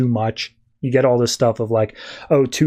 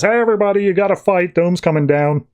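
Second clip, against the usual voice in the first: lightly mimicked booming intercom announcer, pitched up; Telos; 5 semitones higher than usual. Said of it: "Telos booming voice comes over the intercom".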